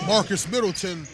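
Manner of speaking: loud, lifted play call; excited call. Real, not acted. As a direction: shout the name as the play lands. Commentator calling a big play.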